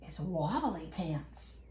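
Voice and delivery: secretive hush. A narrator's reading. Reader shares the secret password with children, softly. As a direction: tell it like a secret.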